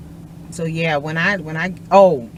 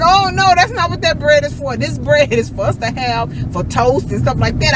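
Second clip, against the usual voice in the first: excited protest; 10 semitones higher, 4 dB louder than usual. Retold or imitated. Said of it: "I was like".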